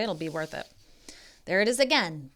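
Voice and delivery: ordinary talk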